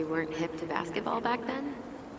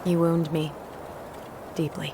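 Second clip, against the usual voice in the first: mock hurt; 5 dB louder than usual.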